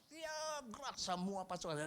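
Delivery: parade commander call. Retold or imitated. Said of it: "Waktu dia bilang".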